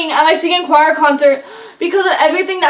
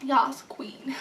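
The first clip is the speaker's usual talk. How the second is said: whisper; 11 dB quieter than usual. Real, not acted. Whispered aside over soda.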